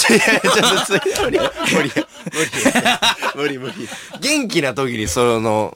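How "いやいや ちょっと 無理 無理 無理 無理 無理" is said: laughing refusal. Real, not acted.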